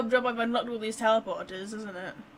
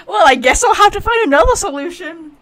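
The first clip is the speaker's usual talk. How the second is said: loud, resigned sigh of a line; loud mock quote; 7 semitones higher, 17 dB louder than usual. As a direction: declare it loudly, giving up. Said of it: "just being like".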